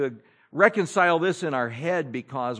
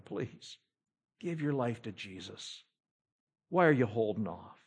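hushed, softer begging; soft pleading; 3 semitones lower, 6 dB quieter than usual. Retold or imitated. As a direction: plead gently, almost whispering. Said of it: "We just pleaded with them".

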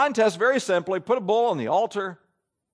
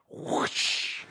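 whooshing fire sound; 6 dB quieter than usual. Acted. Preacher imitates fire from heaven.

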